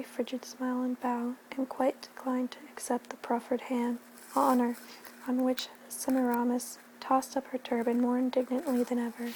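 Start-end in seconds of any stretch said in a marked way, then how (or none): none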